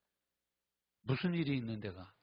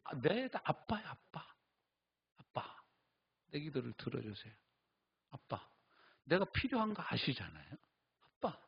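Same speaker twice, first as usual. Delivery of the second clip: tender prayer; 4 dB quieter than usual. Shown as a demonstration. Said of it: "하나님이 누구예요".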